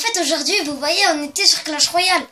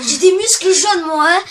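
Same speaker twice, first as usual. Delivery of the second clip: boastful; 7 dB louder than usual. Pretend. Bragging about his muscles.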